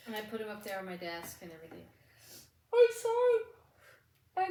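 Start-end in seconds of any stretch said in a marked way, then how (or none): 2.7-4.5 apology stretched through a real yawn. Real, not acted. Late-night recording, she apologises to listeners mid-yawn. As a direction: let a yawn pull the words out slowly, higher and louder. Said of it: "I'm yawning".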